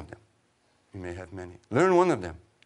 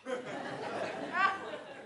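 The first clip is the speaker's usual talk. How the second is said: whispered laugh; 7 dB quieter than usual. Real, not acted.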